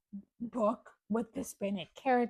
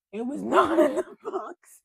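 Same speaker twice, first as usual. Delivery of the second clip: laughing; 6 semitones higher, 9 dB louder than usual. Real, not acted. Laughs at unplanned video.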